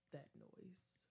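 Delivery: whisper. Real, not acted. Spooky ending of story.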